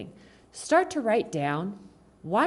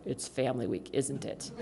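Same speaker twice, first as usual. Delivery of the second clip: mock husband; 6 semitones lower, 9 dB quieter than usual. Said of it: "he'll look at me like".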